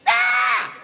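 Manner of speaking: very high, short yell; snapped scream. Acted. Passenger at the far end losing his mind, high scream. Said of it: "he snapped".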